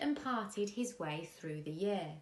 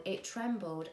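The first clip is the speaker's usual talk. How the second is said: ordinary delivery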